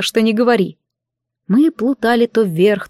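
ordinary delivery

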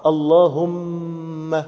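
اللهم with a long hummed م; lips pressed on م. Shown as a demonstration. Preacher showing the labial letter in اللهم, long closed-lip م. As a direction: hold the م of اللهم on closed lips before opening. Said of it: "ضم كده، صح؟".

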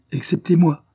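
high, loud protest; spirited, high-pitched retort; quick retort. A narrator's reading. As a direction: jump in with a lively objection. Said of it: "riposta vivement William".

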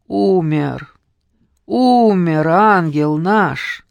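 slow, loud, heavy with grief; grief-stricken. A narrator's reading. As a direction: force the words out through grief. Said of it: "с трудом выговорила няня".

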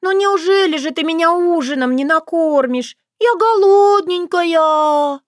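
put-on high, slow babyish whine; baby talk. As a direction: coo it like a needy child. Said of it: "засюсюкала она".

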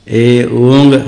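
ए, ओंग held long and apart; each letter distinct. Shown as a demonstration. Teacher modelling the sutra, ए, ओंग slowly separated. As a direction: draw out ए, then ओंग with its ending. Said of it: "प्रत्येक वर्ण उच्चारण".

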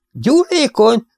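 very high, incredulous question; wide-eyed disbelief. A narrator's reading. Siegfried hears his brother call ether flammable, incredulous. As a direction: let the voice leap up in disbelief. Bátyának elkerekedett a szeme.

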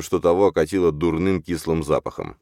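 ordinary delivery, nothing marked

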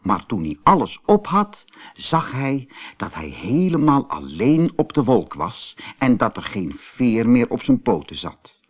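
ordinary delivery, nothing marked

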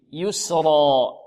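"يسرا" drawn out with heavy ra; slow model word. Shown as a demonstration. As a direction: stretch "يسرا". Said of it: "models word". (نطق "يسرا" ببطء)

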